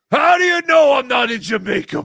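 loud high indignant shout; mock outrage. Host yelling indignantly at the puppet who mocked him. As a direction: yell it back at the puppet.

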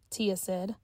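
plain reading voice

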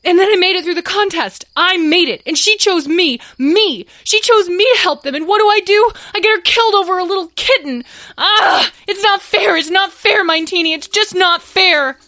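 crying despair. Acted. Tom breaks down before sobbing.

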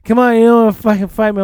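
put-on loud, fast drunken taunt. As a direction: goad him to fight. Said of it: "He's like".

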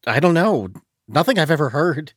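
high, loud voice, stumbling over the description; shaken, groping account. A narrator's reading. Exley tells the shadowy figure of the sound, shaken.

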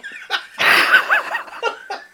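wheezing high laugh; high breathy laugh. Real, not acted. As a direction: squeal with laughter. Laughing at a mad player.

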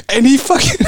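breaking into high laughter mid-sentence; can't finish for laughing. Real, not acted. Laughing while telling story.

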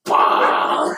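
loud, low "bah" shout; deep, loud retch imitation; imitated vomiting sound. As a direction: heave out a big mock puke noise. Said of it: "That shit said".

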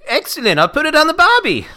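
high, loud mock Aussie-New York accent. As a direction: ham up the barbie line. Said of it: "I'm doing New York".

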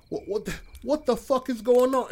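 high, loud stammering freak-out; retold panicked disbelief. Friends facing pizza guy on the frog's back, alarmed yell. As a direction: stumble into a high-pitched, alarmed question.

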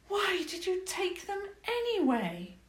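high, curious princess voice; princess asking. Acted. Princess asks why trees were stolen, high voice.

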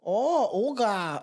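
put-on slow, drawn-out Swedish-accent try at Olga; attempted Swedish accent. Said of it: "I can't do it now".

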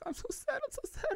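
very high, squeaky fake wail of grief; mock weeping. Man imitating stepmom's grief, exaggerated high mock sobbing. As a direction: whimper in a hammed-up high cry, mocking her.

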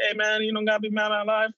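high, fast, preachy mock voice; mock-chipper Spider-Man lecturing a villain. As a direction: squeak it out like a goody-two-shoes hero. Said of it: "Spider-Man's like".

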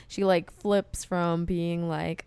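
normal talking voice

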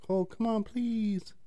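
high, whiny begging imitation; hammed-up needy scammer; mock whiny pleading. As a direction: whine it up very high. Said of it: "they get upset".

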